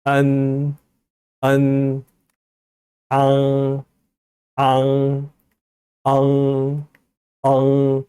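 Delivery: 안 and 앙 held level, ringing in the nose; sustained 안 and 앙 with nasal endings; nasal resonance. Shown as a demonstration. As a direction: let 안 and 앙 hum through the nose. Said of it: "비음을 좀 넣어가지고".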